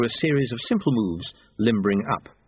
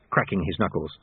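ordinary delivery

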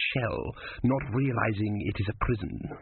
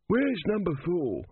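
put-on high, slow querying old man; an old guide, peering and puzzled; 7 semitones higher, slower than usual. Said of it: "An elderly voice said".

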